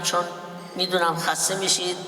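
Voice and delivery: ordinary talk, nothing marked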